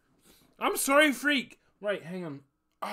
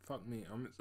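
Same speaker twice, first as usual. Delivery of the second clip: ordinary talk, nothing marked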